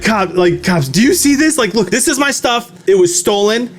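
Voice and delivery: retold frantic appeal; freaking out. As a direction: plead your case urgently, voice climbing. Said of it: "I'm freaking out".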